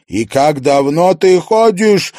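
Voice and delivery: high, loud, slow hysterical cry; hysterical shout. A narrator's reading. Daughter accusing her mother of faking, hysterical shout. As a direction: scream it in hysterics. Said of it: "истерично крикнула она".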